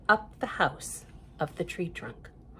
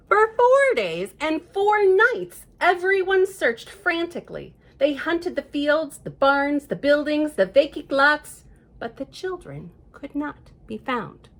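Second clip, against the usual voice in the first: slow, loud and higher, building suspense; dramatic storytelling; 6 semitones higher, 5 dB louder than usual. A narrator's reading. Reading a child the worried search, dramatic and slow. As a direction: stretch each phrase to make it tense.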